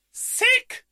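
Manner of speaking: very high-pitched, loud squeal; loud exclamation. A narrator's reading. Marina lands her first backflip, loud exclamation. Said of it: "rief sie laut".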